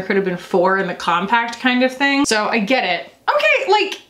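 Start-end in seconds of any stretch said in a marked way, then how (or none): 3.3-4.0 high, surprised okay; high, pleased surprise at the bronzer. Real, not acted. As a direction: let it pop up high in surprise.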